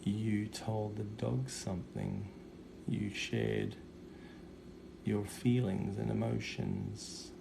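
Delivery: soft slow psychic voice. Acted. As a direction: hush it like a medium. Psychic reading, soft delivery.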